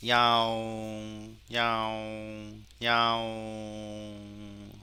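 long-held meows on one level note; cat meow imitation. Shown as a demonstration. Teacher testing the cat detection, imitated meowing. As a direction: meow slowly, stretching the vowel. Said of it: "고양이 한번 해볼까요".

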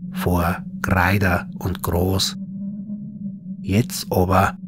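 plain reading voice

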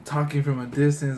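usual speaking voice

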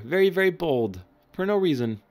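mock outrage.

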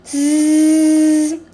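loud imitated buzz; buzzing sound. The strange noise waking the zebra, a loud buzz. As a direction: buzz like an insect at her ear. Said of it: "buzzing sound".

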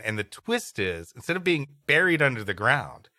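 normal talking voice

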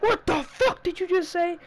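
high mock yell of an angry kid; imitating a kid yelling in a fight; retold scream. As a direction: throw out a high angry challenge, half-shouted. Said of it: "screaming at the top of his lungs".

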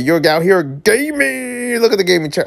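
high voice, stretched-out catchphrase; gleeful hype. Acted. Reader admiring roommate's gaming setup, gleeful hype. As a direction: gush high, drawing the last word out.

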